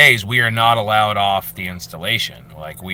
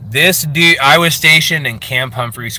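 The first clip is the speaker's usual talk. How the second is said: ordinary delivery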